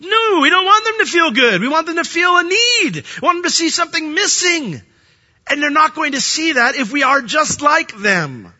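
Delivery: loud, high-pitched pulpit insistence; forceful preaching. Real, not acted. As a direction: cry it out to the congregation.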